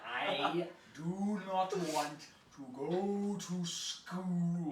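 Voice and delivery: "I do not want to go to school" loud, high and hugely drawn out; exaggerated enunciation. Shown as a demonstration. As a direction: pull every vowel of "I do not want to go to school" long. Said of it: "Try to get your whole face in there".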